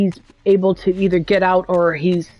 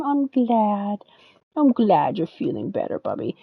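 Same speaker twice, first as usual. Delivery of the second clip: soft pet talk; 5 dB quieter than usual. Real, not acted. Soothing the cat on her lap.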